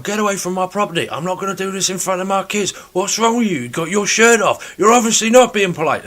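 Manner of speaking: retelling a viral clip of an outraged homeowner, mocking and building; mock indignant shouting. Imitating the shouting bloke.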